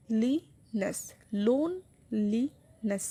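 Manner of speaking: Loneliness in slow, separate syllables, লিনেস lighter; stress on first syllable. Shown as a demonstration. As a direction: draw out লোন, then place লি and নেস lightly. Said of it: "বেশি stress".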